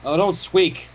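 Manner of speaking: usual speaking voice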